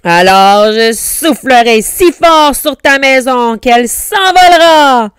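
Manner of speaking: loud wolf threat climbing to a high finish; big, menacing storybook roar; growling threat. A narrator's reading. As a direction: bellow it as the angry wolf. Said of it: "grogna le loup".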